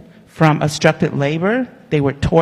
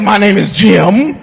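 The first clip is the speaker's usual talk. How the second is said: slow, louder line through laughter; laughing; 6 semitones higher, 4 dB louder, slower than usual. Retold or imitated. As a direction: say it while giggling. Owner correcting her, she laughs.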